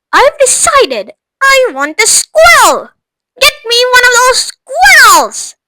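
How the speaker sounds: high, loud bratty demand; spoilt girl demanding. Acted. Veruca demands a squirrel from her mother, bratty shout. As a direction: whine it like a spoilt child.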